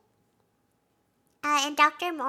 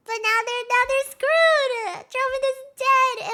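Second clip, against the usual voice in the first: excited; 11 semitones higher, 10 dB louder than usual. Real, not acted. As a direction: belt out the shock, slowing on screwed. Shock at the plot twist.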